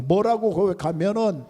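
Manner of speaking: ordinary talk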